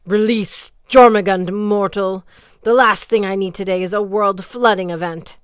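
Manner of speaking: loud, booming order; commanding goddess. A narrator's reading. Sea goddess Ran orders Magnus, booming.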